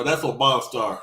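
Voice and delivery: usual speaking voice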